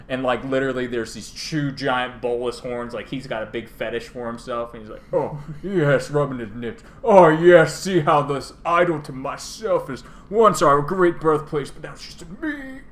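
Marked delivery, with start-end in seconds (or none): 5.1-12.8 high, louder purring self-adoration; vain self-admiring dragon. Retold or imitated. Nicol Bolas admiring his own idol, vain high purring. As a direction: coo over your own statue.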